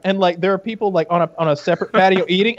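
ordinary delivery

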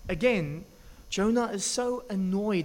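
normal talking voice

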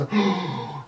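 sharp gasp before a cry; sharp startled gasp. Acted. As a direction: suck in air in shock. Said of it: "a similar voice".

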